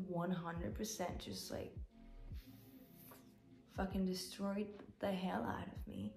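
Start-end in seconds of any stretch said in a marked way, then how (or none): 3.8-6.2 whispered with a small laugh; whispering. Real, not acted. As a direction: breathe it out in a whisper. Whispers about being destroyed.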